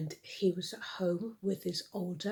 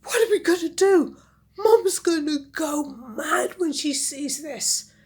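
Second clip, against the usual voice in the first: much higher, louder near-crying panic; panicking sisters; 9 semitones higher, 12 dB louder than usual. Acted. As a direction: wail in fear of Mum. Sisters panic about Mum.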